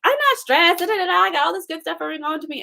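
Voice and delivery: high, quick brushing-off voice; breezy denial. Retold or imitated. To her aunt, brushing off the worry, high and quick. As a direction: wave it away with a high fast "I'm fine". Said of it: "I was like".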